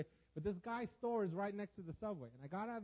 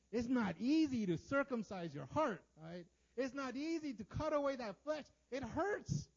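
raised, high, emphatic delivery; forceful, pitched-up insistence; impassioned preaching; 6 semitones higher, 5 dB louder, slower than usual. Real, not acted. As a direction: drive the pain home to the congregation. Preacher pressing how much it hurts.

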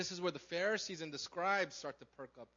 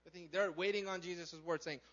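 ordinary talk

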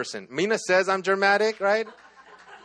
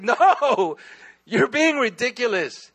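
high, loud retold protest, drawn out at the end; arguing; 7 semitones higher, 3 dB louder, much slower than usual. Man retelling his argument with God, high protesting tone. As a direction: push back in exasperation, stretching the words. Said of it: "having this argument with God".

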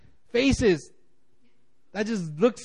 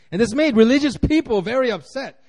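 ordinary talk

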